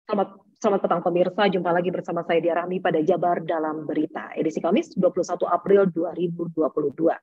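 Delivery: low-pitched, even TV news read; news reader. Shown as a demonstration. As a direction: read the bulletin in a low anchor voice. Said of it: "Jadi kalau misalnya baca berita itu".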